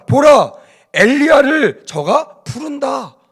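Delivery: high, slow, mocking aloofness; detached mockery. Onlookers mocking the dying Jesus, high and slow. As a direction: say it like staring at a far mountain, not caring. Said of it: "먼 산 바라보듯이".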